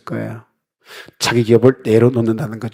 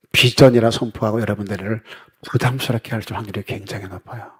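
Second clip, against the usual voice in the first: ordinary talk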